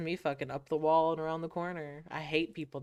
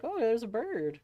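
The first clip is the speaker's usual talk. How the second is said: distracted player; 7 semitones higher than usual. Acting distracted player spotting bird.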